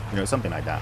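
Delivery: ordinary delivery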